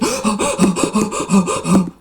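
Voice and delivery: rapid hammed-up gasping; mock panicked gasps. Vlogger acting out fight-or-flight state, in exaggerated panicky gasps. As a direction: gasp over and over as if terrified.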